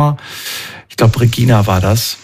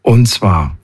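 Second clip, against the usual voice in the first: normal talking voice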